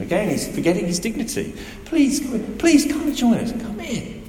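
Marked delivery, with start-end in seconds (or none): 1.9-4.2 high, begging please; a father pleading with his angry son. Acted. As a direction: beg your son to come inside. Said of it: "he pleads with him".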